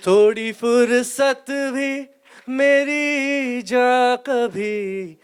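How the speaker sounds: high, slow female singing voice; man doing a woman's voice. Shown as a demonstration. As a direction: sing it like the girl in the song. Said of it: "वो है लड़की की आवाज में".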